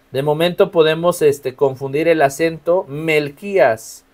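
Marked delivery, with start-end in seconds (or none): 2.9-4.0 Melquías drawn out slowly, accent on the Í; mel-KÍ-as, leaning on the I of Melquías; slow, careful Melquías stressed on KÍ. Shown as a demonstration. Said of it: "acento en la I".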